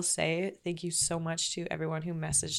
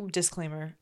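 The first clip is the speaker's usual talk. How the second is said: ordinary talk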